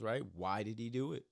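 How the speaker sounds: ordinary talk, nothing marked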